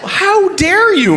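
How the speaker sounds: high, drawn-out mock outrage; indignant scolding. Caller rebuking the president, indignant and high. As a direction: build the rebuke slowly at a high, affronted pitch.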